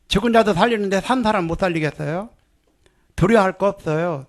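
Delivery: ordinary talk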